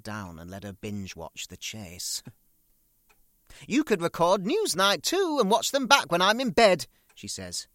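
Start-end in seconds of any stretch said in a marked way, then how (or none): 3.7-6.9 high, loud mother's voice; shrill-free high mocking mother; mother voiced. [name] voicing his mother.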